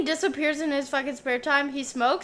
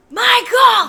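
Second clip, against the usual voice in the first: high, loud shout of a name; imitated yell; 10 semitones higher, 9 dB louder than usual. Heroine hunting the killer with an axe, imitated yell. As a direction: holler it like the movie heroine. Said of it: "She goes".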